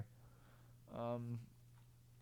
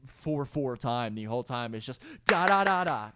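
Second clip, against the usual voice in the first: mock-bored listener groaning at the beat, getting louder; 9 dB louder than usual. A producer mocking a bored listener's complaint, louder at the end. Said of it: "the listener to be like".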